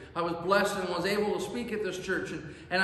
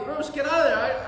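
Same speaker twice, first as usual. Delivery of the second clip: mock yell, higher and louder, a wife calling her husband away; retold yell; 8 semitones higher, 5 dB louder than usual. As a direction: call him out of danger in a half-acted shout. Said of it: "Cheryl would yell at me".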